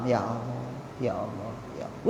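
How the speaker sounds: a hushed plea to God, barely voiced; soft night prayer. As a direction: pray quietly in the dark. Mother praying at night. (doa lirih tengah malam)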